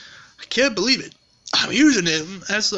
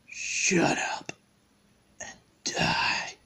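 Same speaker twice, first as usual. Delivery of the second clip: low, whispered threat; menacing villain; 4 semitones lower, 8 dB quieter, slower than usual. Acted. Villain silences the taunting bear, menacing whisper. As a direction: hush it right down, slow and deadly.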